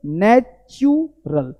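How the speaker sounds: नेचुरल broken slowly into "natch - yu - ral"; syllable by syllable. Shown as a demonstration. Teacher models the word for learners, slowly broken up. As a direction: spell out नेचुरल by sound, with gaps. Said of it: "यानी क्या बोलेंगे?".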